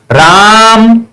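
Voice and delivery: calling from afar, last vowel stretched; long drawn call. Shown as a demonstration. Teacher shows calling Ram from afar, vowel drawn long. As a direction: stretch the final vowel as if calling someone far off. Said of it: "दूर से बुलाने के लिए ऐसे बोलते हैं".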